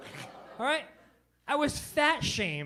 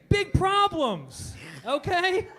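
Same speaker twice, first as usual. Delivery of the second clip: higher, louder and slow; mock alarm; 7 semitones higher, 7 dB louder, much slower than usual. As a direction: hit it like a punchline in distress. Comic exaggerating workout pain.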